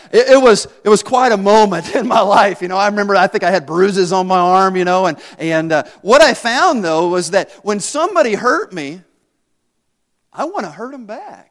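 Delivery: normal talking voice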